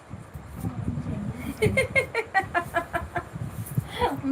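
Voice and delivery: a quiet, breathy laugh at her own joke; soft amused laugh. Real, not acted. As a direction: let a soft chuckle slip out.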